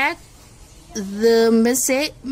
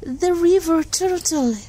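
story narration; 3 dB quieter than usual. Acted. Reads animal story to class.

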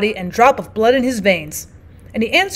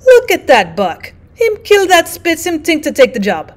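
amused; 6 semitones higher, 4 dB louder than usual. Acted. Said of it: "Francois delighted at Buck".

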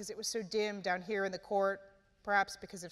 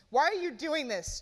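demanding; 6 semitones higher, 5 dB louder than usual. A narrator's reading. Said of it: "Jace demanded".

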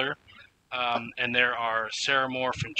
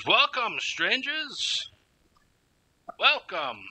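put-on high, welcoming gnome with a dwarf accent; 12 semitones higher, slower than usual. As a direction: hail strangers in a high-pitched dwarf accent. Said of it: "here I am with my dwarf accent".

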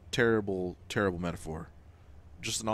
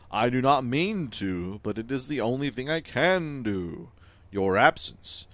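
grand, louder timeless Weaver; 4 semitones higher, 7 dB louder than usual. Acted. Game Weaver laments to the player, grand and louder. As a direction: speak as an ancient weaver of time.

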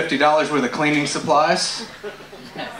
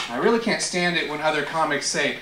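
ordinary delivery

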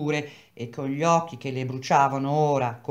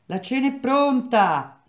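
calling out; 8 semitones higher than usual. Acted. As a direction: shout it across the street. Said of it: "costei gridò".

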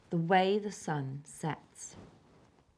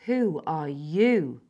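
louder, slow and firm; firm and determined; 7 dB louder, much slower than usual. A narrator's reading. Wild girl challenging the intruder, firm demand. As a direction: demand it back, unyielding. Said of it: "Her voice was firm and determined".

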